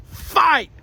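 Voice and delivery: high, short mock battle cry; mock rallying cry. Trump with fist in the air, mock rallying cry. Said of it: "he said".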